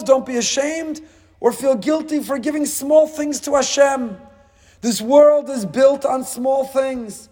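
high-pitched, sustained sermon fervour; impassioned preaching. Real, not acted. Preacher urging listeners to value small gifts, in impassioned preaching. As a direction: drive each phrase home from high in the voice. Preaches the point at full force.

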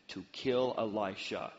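usual speaking voice

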